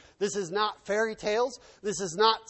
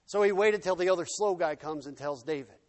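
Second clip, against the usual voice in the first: normal talking voice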